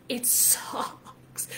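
drawn-out whisper for emphasis; hushed, breath only, leaning into the misery; anguished whisper. Real, not acted. Coach admits to listeners how bad it feels, anguished whisper.

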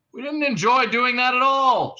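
high, loud protest; shouting. A narrator's reading. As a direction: shout it earnestly across the room. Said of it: "shouted the other cop".

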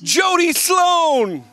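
high, drawn-out name like an emcee; big showy introduction; announcer intro. Pretend. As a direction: announce the star, stretching out the name. Introducing guest to cheering crowd.

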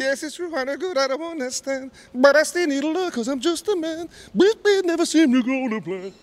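very high, belted falsetto croon. Retold or imitated. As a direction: soar up into a soulful falsetto. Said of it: "that, that sound".

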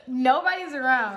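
real laugh breaking into the words; trying to talk while cracking up; tearful laughter. Real, not acted. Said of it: "I'm about to cry telling this story 'cause it's so funny".

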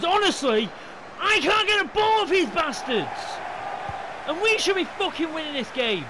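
loud, high, fuming rant. Real, not acted. Gamer who can't get the ball, fuming shouts. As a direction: yell out real frustration, fuming. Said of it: "I'm getting fucking fuming".

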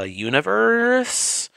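"Universe" drawn out in a high rising question; doubtful question. Real, not acted. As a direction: stretch "Universe" upward, unsure of it. Unsure of the toy line name.

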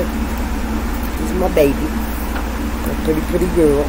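tender cooing to dog. Real, not acted. Cooing over her dog Portia.

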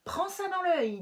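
high, loud battle cry; fighting shout. Acted. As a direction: yell it as you swing. Rabbits fighting in story.

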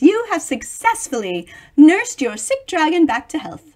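plain reading voice

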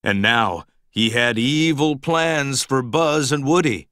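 ordinary delivery, nothing marked

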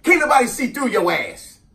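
aunt scolding. Retold or imitated. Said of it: "her response would be".

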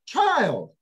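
put-on sharp, high, loud call of Child; scolding mother. Mary interrupting the teachers to scold her son. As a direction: bark it out like an exasperated mom. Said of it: "Mary just interrupts the whole shebang".

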